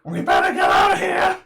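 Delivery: high frantic shouting; panicked yell. Acted. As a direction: scream it out in fear. NPC yells in fear.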